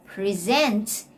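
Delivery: "Present" stressed on SENT; the verb "Present", pre-ZENT; stress on second syllable. Shown as a demonstration. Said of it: "take note of the stress".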